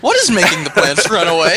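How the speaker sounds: baffled outburst. Real, not acted. Player puzzled by the game's fleeing plants, baffled outburst. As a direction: demand an explanation, raising the voice. Repeats question, more baffled.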